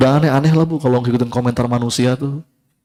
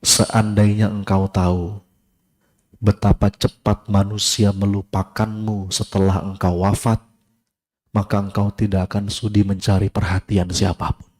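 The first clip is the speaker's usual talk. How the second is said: reading a quote solemnly; 7 semitones lower, 3 dB quieter than usual. Shown as a demonstration. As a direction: read the scholar's words gravely. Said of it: "Kalimatnya gini". (bacakan kutipan ulama dengan khidmat)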